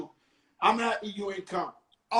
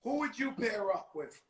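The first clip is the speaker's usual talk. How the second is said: normal talking voice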